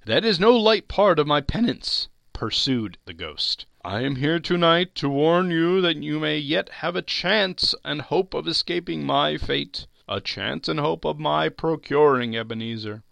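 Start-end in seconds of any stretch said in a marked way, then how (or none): 0.1-2.1 high, loud ghostly lament; penitent ghost. Acted. Ghost speaks in the story. 3.8-13.0 high-pitched, solemn spectral warning; warning ghost. Acted. Ghost warns Scrooge.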